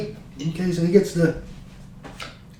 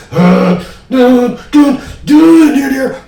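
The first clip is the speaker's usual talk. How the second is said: high, loud sung organ blasts; mimicked organ riff; 9 semitones higher, 10 dB louder, much slower than usual. Recalling that great big monster organ, loud imitated riff. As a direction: belt out a monster organ part by mouth.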